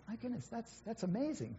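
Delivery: high, soft gasp of amazement; amazed. Retold or imitated. Pastor recalling hearing of the converts, amazed. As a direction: marvel at it under the breath. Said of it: "and I think".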